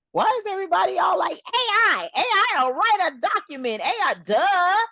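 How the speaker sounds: high, fast mocking voice of the hype crowd; mocking clueless crowd. Mocking everyone hyping AI in a high fast voice. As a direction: parrot the hype in a silly high voice. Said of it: "I'm like, Hmm?".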